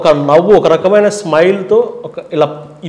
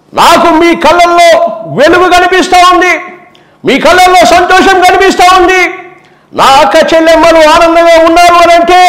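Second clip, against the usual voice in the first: much higher, loud rally speech; Jagan, high-pitch rally; 11 semitones higher, 4 dB louder than usual. Retold or imitated. Jagan impression addressing a public rally, high and loud. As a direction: address the whole crowd at full voice. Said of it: "high పిచ్లో మాట్లాడితే అలా ఉంటుంది".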